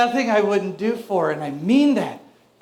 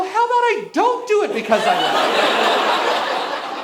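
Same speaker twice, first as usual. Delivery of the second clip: mock retort; 9 semitones higher than usual. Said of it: "I go".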